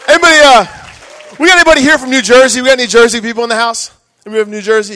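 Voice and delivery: high, loud shout to the crowd; calling out. Real, not acted. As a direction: holler out to the whole room. Comic calls out to the crowd.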